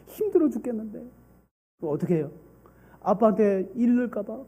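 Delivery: normal talking voice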